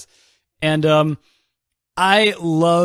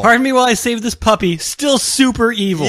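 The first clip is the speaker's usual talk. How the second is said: mock villain; 8 semitones higher than usual. Mocking Hal as fake villain.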